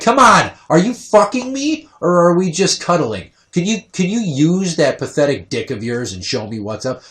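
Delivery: a high, loud mocking judge voice at first; hammed-up taunting impression; mock Judge Judy. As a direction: sneer at him like a scornful old judge. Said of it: "She'd be like".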